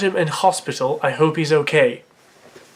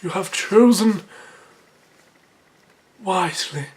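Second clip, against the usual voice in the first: lightly voiced knight, slightly raised; solemn movie quote with a long pause; Holy Grail knight; 3 semitones higher than usual. Imitating the film knight judging a choice, knightly voice. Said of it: "the knight from fucking Indiana Jones".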